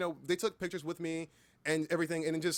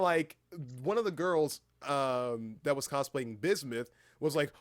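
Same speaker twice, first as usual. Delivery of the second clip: usual speaking voice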